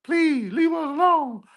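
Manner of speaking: high, whiny mock pleading; mocking high begging voice; begging enemies. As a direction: imitate them begging for mercy, high and pleading. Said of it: "Now they begging and pleading like".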